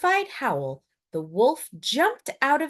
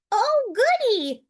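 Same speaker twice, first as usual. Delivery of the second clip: put-on high, louder, bright old-lady voice; delighted Granny; 6 dB louder than usual. A narrator's reading. Granny greeting her visitor, high and loud. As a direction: squeal it like a happy granny. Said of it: "said Granny".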